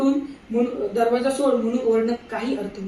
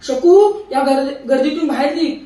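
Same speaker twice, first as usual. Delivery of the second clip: calling out over a crowd; shouting; 3 semitones higher, 8 dB louder than usual. A narrator's reading. Husband shouts to wife Shaku through the crowd, loud call. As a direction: shout to her across the platform. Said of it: "सारत ओरडले".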